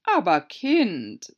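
drawn-out scolding sigh; Herzogin, tadelnd. A narrator's reading. (Herzogin tadelt Alice langsam und lauter)

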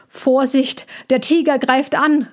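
a lightly voiced frightened man calling out; calling out a warning. A narrator's reading. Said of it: "rief einer der Menschen".

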